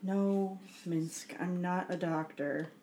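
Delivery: ordinary talk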